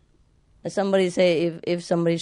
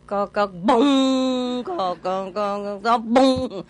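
slow, drawn-out cock cock, then a ringing bong; knocks and gong; 2 semitones higher, much slower than usual. Retold or imitated. Monks beating the wooden fish and gong, slow imitation. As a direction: chant the knocks slowly and end on a bong. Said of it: "they also beat on the gong".

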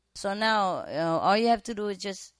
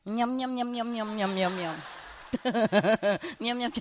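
imitated munching; 3 semitones higher than usual. Bird munching its peanuts, imitated.

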